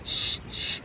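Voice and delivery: a retold shushing, breathed with no voice; hushing whisper. Roommates hushing each other in the dark, whispered shush. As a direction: shush them like someone trying not to wake a sleeper. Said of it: "then I hear them saying".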